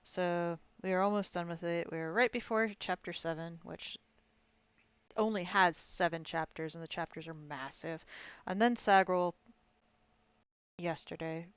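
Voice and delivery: ordinary delivery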